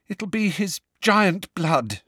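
high, slow and unsettled; shaky. A narrator's reading. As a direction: say it shakily, still upset by the shock. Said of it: "said Hermione shakily".

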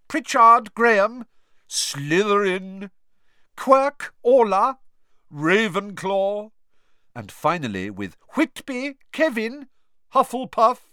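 loud, high names called across a hall; calling out names. Acted. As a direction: announce each pupil to the whole hall.

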